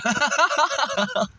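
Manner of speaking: high burst of laughter; a giggle breaking out. Real, not acted. Laughing at her own rant to the camera.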